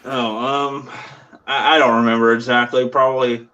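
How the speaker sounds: toothbrush in mouth; while brushing teeth. Real, not acted. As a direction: talk around a toothbrush. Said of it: "I'm brushing my teeth, so hold on".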